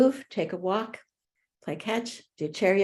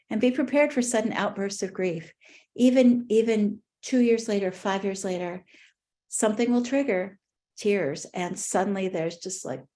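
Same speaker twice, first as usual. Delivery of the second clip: ordinary talk, nothing marked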